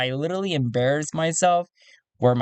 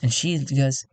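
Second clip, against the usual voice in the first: ordinary delivery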